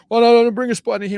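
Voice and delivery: fast, high, loud pleading; hammed-up frightened protest; mock panic. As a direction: beg them to keep it away. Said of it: "We're like".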